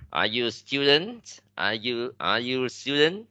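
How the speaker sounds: yes-no question, pitched high and rising on "student"; rising question intonation. Shown as a demonstration. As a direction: lift your voice at the end on "student". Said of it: "sẽ hơi lên giọng ở cuối câu".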